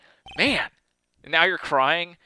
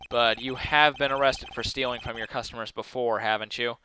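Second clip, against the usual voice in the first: ordinary talk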